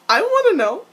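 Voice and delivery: high, loud fan plea; eager demand. Acted. Fan begging the character for details, eager high shout. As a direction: pipe up loudly, dying to know.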